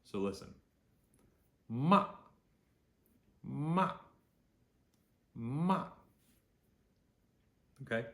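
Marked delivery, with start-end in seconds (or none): 1.7-5.9 MA loud, starting high, dropping sharply; sharp falling fourth tone. Shown as a demonstration. Teacher says be forceful, MA high and falling sharply. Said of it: "It's a high to low, sharp falling tone".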